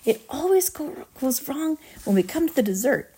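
high, fretful complaint; exasperated lament. A narrator's reading. Wonka laments his faulty dessert course, exasperated complaint.

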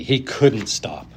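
ordinary delivery, nothing marked

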